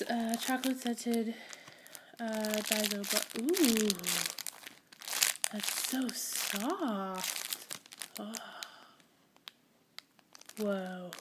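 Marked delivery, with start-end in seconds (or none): 3.4-11.1 1 slow awed. Real, not acted. Feeling soft squishy, awed.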